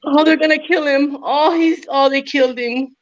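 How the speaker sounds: high mock grandma shouting at her telenovela; grandma yelling at the TV. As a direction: squawk at the screen like an upset grandma. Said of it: "your grandma at home be yelling".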